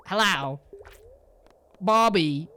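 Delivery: loud cartoon mom impression, terrible accent; Linda Belcher. Streamer doing a cartoon mother's voice for chat, bad accent. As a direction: call out with exaggerated drawn vowels, badly faked accent. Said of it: "what Linda Belcher would say".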